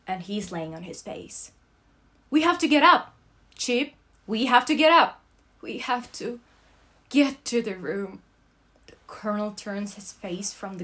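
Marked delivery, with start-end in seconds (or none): 2.3-8.2 loud, high, desperate urging; urgent shout. Acted. Narrator urging the fallen Colonel to get up, shouting. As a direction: yell at him to get up.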